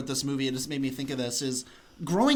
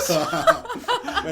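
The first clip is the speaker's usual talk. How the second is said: laughing; 5 dB louder than usual. Real, not acted. Teasing the host.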